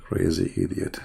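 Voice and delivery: muttered insult. Real, not acted. Muttered insult after rant.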